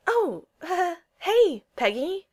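high, slow, hesitant greeting; flustered, halting and high-pitched; stammering. A narrator's reading. Steve greets Peggy as she strolls up, stammering. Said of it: "Steve stammered".

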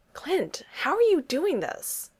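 high, slow incredulous question; can't believe what he's seeing; amazed disbelief. A narrator's reading. Steve asking Clint how he eats the wings. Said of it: "Steve amazed at Clint".

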